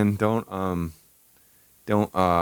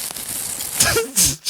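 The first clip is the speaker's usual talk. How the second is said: high breathy laugh; 11 semitones higher than usual. Real, not acted. Laughing at the line.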